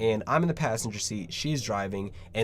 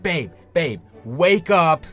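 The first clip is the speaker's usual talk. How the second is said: louder, pushy nudge to wake her; urgent wake-up call; 6 semitones higher, 8 dB louder, slower than usual. Retold or imitated. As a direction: shake her awake with your voice. Urgently waking girlfriend.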